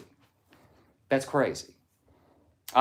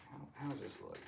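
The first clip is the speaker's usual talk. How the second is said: ordinary talk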